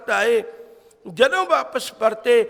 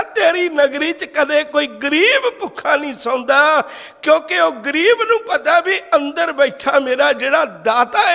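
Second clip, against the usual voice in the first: raised, emphatic sermon delivery with swooping stresses; fervent preaching; 3 semitones higher, 4 dB louder than usual. Real, not acted. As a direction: proclaim it proudly to the congregation. Preaches with fervour. (ਜੋਸ਼ੀਲਾ ਕਥਾ ਪ੍ਰਚਾਰ)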